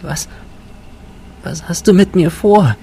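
put-on high, small, halting girlish question; frightened high-pitched question; whispered fearfully. A narrator's reading. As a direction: ask timidly in a high voice, faltering at first. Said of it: "flüsterte sie".

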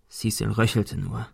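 ordinary delivery

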